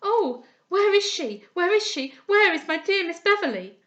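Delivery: put-on desperate girl searching aloud; frantic exclaiming. A narrator's reading. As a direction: call out wildly for a lost friend.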